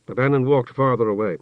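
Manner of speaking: usual speaking voice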